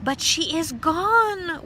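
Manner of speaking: high, loud slow wail; a mother in despair; high drawn-out wail. A narrator's reading. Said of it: "wailed Mother Lumps".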